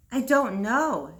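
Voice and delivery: ordinary delivery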